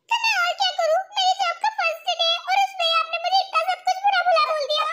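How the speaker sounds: high, whiny mock crying; tearful complaint. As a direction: keep up the put-on sobby complaint. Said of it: "रो क्यों रही हो".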